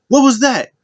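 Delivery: imitating a heated challenge; mock heated yell. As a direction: snap at him like a furious teammate. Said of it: "comes in mad heated".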